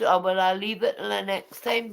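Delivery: usual speaking voice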